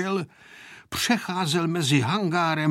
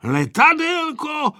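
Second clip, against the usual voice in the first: high, drawn-out echo; questioning echo; 12 semitones higher, 5 dB louder, slower than usual. A narrator's reading. Grandpa echoing the boy's request, drawn-out questioning. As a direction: stretch the word loudly in doubt.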